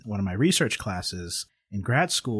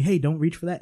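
higher, louder retold order; retold warning; 8 semitones higher, 5 dB louder than usual. Officer warns him off the bag, retold urgent warning. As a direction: call out a sudden warning, as if quoting it.

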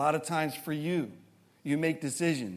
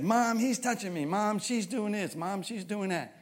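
put-on high whiny kid tattling to mom; whiny tattling little sibling; 8 semitones higher than usual. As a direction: whine like a kid telling on a sibling. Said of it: "they started yelling to their mom".

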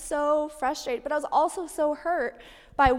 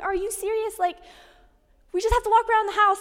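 higher, exasperated and fast at the end; retold exasperation; 8 semitones higher, faster than usual. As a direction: scoff in disbelief at a toddler, pitched up. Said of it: "I'm just in this mode".

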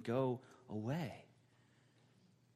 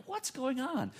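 much higher, alarmed inner cry; a teen panicking as a stranger pounds on the window; freaked-out thought; 12 semitones higher, slower than usual. Retold or imitated. Young driver panicking after his crash, alarmed. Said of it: "I'm freaking out in my mind".

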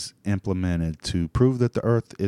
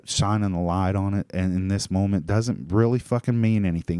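ordinary talk

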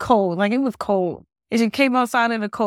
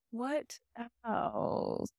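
whispered to herself, much softer; whispered aside; 3 semitones higher, 15 dB quieter than usual. Real, not acted. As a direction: breathe the words like a private thought. Musing to herself, trailing off.

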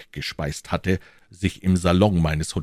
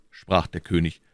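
usual speaking voice

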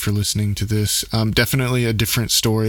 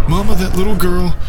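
high, louder panicked cry; terrified scream; 10 semitones higher, 11 dB louder than usual. Acted. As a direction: point out the girl in terror. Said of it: "Ezra screamed".

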